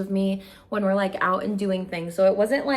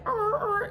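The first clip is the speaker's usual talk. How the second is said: scared whimper; 14 semitones higher than usual. Retold or imitated. Imitating fear on windy tower.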